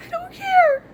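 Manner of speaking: tearful, defiant cry of the words; crying defiance. Real, not acted. Vlogger defending her awful singing, crying out high. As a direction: voice jumps high and loud through tears.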